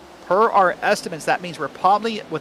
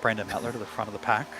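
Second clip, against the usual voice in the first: hushed, lower golf commentary; golf announcer voice; 5 semitones lower, 8 dB quieter than usual. Pretend. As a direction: murmur it like a golf broadcast. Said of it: "break out your golf announcer voice".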